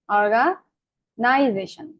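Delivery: Organisation very slowly, split after অর্গা, with নাই held long; অর্গা, pause, then a long clear নাই-জেশন; stress on নাই. Shown as a demonstration. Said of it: "লম্বা করলাম".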